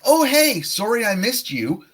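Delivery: bright high casual hello; leaving a friend an upbeat voicemail; friendly voicemail greeting. Shown as a demonstration. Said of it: "and then you can say".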